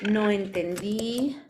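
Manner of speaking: "No entendí" with the last í drawn out; slow "No entendí", holding the accented final í; stress on final í. Shown as a demonstration. Teacher showing where the past tense takes its accent, final í of "entendí" stretched. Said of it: "Acento en la I".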